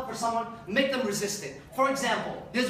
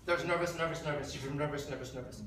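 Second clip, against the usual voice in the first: each nervous lower and softer, fading away; fading repetition; 8 semitones lower, 7 dB quieter than usual. Shown as a demonstration. Showing how repeating nervous drills it in, fading lower. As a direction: let the word sink and fade. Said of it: "demonstrating repetition".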